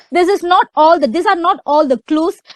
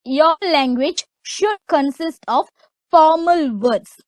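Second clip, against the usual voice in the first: usual speaking voice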